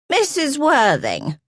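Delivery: slow, high call, part glad and part cross; half pleased, half angry. A narrator's reading. Grandmother calls to the housekeeper, half pleased, half angry. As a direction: greet her old servant with mixed relief and annoyance. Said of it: "half pleased, half angry".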